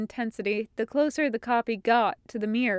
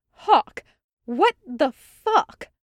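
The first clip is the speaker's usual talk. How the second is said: exasperated; 5 semitones higher, 7 dB louder, much slower than usual. A narrator's reading. Exasperated captain scolding Hawk.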